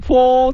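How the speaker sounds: high, long hollow "hoo"; imitated shakuhachi note. Joking about shakuhachi as a hobby, imitated flute note. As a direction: hoot one held bamboo-flute note. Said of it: "趣味は尺八です".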